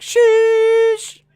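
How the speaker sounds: high, loud, drawn-out shush at the crowd. Acted. Streamer shushing a rowdy crowd, loud high shush. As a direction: shush them down hard. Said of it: "I just shooshed everyone".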